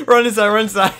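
urgent words through laughter; laughing. Real, not acted. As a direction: giggle while yelling orders. Laughing while fleeing enemies.

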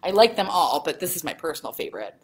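ordinary delivery, nothing marked

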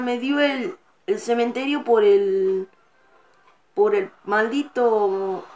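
ordinary talk, nothing marked

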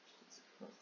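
breathless whisper; whispered disbelief. Real, not acted. Reader whispering in despair at the text. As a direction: breathe it out, defeated.

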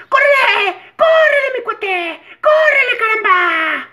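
put-on very high, loud childlike shouting; excited kid host squeal; [name] voice. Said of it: "[name], y dice así". (voz infantil muy aguda y gritona)

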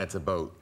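"a boat" for "about"; Canadian "about". Shown as a demonstration. Asked to say about, Canadian pronunciation "a boat". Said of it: "well, in Canada".

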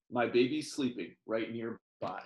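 usual speaking voice

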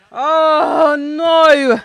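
anguished groan. Real, not acted. Team concedes late goal.

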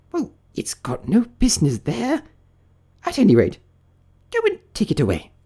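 high, huffy rabbit voice; bossy rabbit. Acted. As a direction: give the order in a high, cross voice. Rabbit orders Pat to remove arm.

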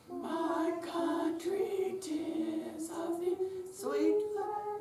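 high, slow, strained sung line; a hint of a rough damaged voice. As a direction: sing through damaged vocal cords. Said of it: "It's called damaged voice".

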